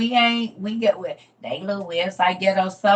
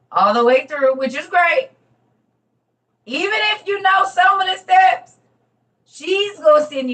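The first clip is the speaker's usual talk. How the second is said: rising to an excited near-shout, much higher and louder; building from excited to a thrilled, much higher shout; excited praise; 12 semitones higher, 10 dB louder than usual. Real, not acted. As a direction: gush about her, getting louder and higher until you almost shout. Excited praise of the helpful mod.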